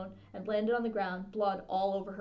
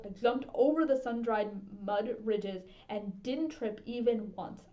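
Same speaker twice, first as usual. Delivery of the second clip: usual speaking voice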